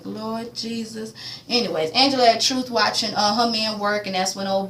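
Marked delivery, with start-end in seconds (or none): 0.0-1.1 slow, soft, drawn-out "Lord Jesus"; hushed Lord Jesus. Retold or imitated. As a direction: breathe it out slowly in dismay. Own worried reaction.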